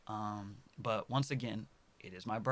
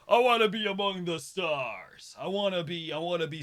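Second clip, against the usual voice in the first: higher, louder yearning cry; soaring ambition; 7 semitones higher, 8 dB louder than usual. Real, not acted. Telling viewers his dream, soaring ambition. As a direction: reach for the stars with it.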